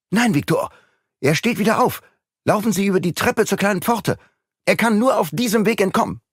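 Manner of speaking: high, fast, urgent orders; urgent shout. Acted. Urgent orders across distance.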